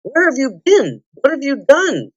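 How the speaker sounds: high, urgent questioning; two pressing questions, pitched well up; astonished demand. A narrator's reading. King demands to know what Jill did, high and astonished.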